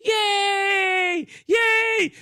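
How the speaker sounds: high, loud hammed-up cheering; mock crowd cheer. Crowd cheering the wrestler, high mock cheer. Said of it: "And the crowd goes".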